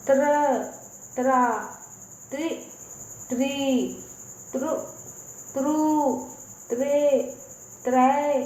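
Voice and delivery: syllable by syllable, teacher's drill; reciting Hindi barakhadi to kids; barakhadi recitation. Shown as a demonstration. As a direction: chant each syllable slowly and clearly for children. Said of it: "चलिए बच्चों आगे चलते हैं।".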